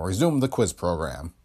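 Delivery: ordinary delivery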